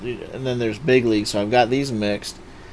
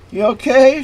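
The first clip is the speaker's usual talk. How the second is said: concerned; 12 semitones higher, 8 dB louder than usual. Real, not acted. Checking on scared dog.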